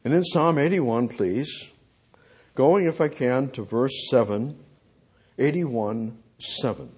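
normal talking voice

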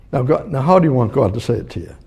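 ordinary talk

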